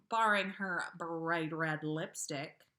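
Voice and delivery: plain reading voice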